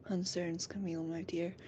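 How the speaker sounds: soft, half-whispered tender reply; soft fatherly whisper to Camille; tender, smiling. Acted. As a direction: gently reassure him, smiling. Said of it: "smiling goes".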